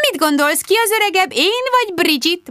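high-pitched, playful singsong question; mischievous, high coaxing voice; playful teasing. A narrator's reading. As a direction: tease a small child in a high voice. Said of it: "folytatta dévajul Rebecca".

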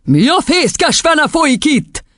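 high, slow, outraged roar; bellowing. A narrator's reading. Headmistress seeing the chalk write, bellowing. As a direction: demand an explanation in fury. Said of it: "bömbölte Doron kisasszony".

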